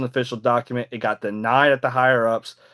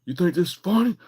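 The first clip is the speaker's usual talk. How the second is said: drill instructor yelling; 11 semitones higher than usual. Retold or imitated. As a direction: chew out the recruit like a drill instructor. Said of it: "drill instructor or something, like, got on my ass".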